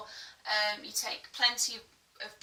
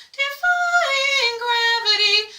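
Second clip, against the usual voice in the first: breathy head voice; 13 semitones higher, 11 dB louder, much slower than usual. Shown as a demonstration. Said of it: "it can sound quite breathy".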